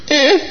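put-on short, high baby squeak; high-pitched little infant bleat; baby's cry. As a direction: imitate a tiny faraway baby cry. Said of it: "the baby on the third floor goes".

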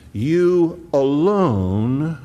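"You alone" stretched very slowly, YOU rising; emphatic you alone. Shown as a demonstration. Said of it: "it's emphatic".

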